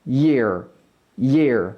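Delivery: YEAR opened with a y sound, falling; y-glide. Shown as a demonstration. Teacher drilling the word being studied, YEAR with a y-glide. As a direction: say YEAR low and deliberate with a y in front. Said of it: "우리가 공부하고 있는 단어는".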